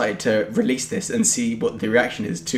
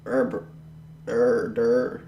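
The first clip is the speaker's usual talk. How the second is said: American impression; 3 dB quieter, slower than usual. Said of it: "That's your impression of Americans".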